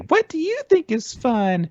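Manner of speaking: very high, squeaky fairy; high-pitched sprite asking the audience. Acted. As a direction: put on a tiny fairy falsetto. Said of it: "fairies actually go out and start talking".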